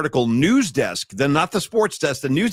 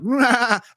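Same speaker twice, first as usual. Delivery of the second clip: higher-pitched goat bleat; goat sound; 8 semitones higher than usual. Shown as a demonstration. Host bleating to back a caller's GOAT pick, goat bleat. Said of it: "That's my goat sound".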